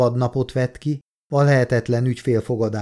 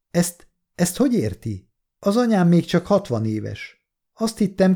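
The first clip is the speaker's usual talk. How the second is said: upset, markedly higher voice; distressed disbelief; 7 semitones higher than usual. A narrator's reading. Daughter questions the doctor about her dying mother, high distressed disbelief. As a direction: protest the bad news in high-pitched alarm.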